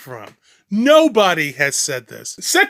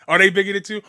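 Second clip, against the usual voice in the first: usual speaking voice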